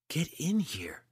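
urgent, forceful whisper; harsh whisper. A narrator's reading. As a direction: order him inside under your breath. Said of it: "Eleni whispered harshly".

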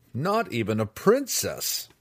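drawn-out scoffing student; a lightly voiced sneering student. Student sneering over the child's corpse, drawn out. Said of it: "he declared".

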